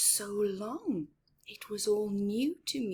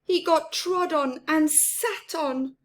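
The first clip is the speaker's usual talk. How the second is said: much higher, sing-song reading for little ones; storybook narration; 8 semitones higher, 10 dB louder than usual. A narrator's reading. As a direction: make the mouse sound tiny and sweet. Picture book read aloud.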